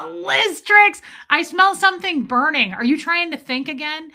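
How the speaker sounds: high, loud teasing burn; zing them with it; playful high roast; gleeful roast. Acted. Catchphrase burn called out gleefully.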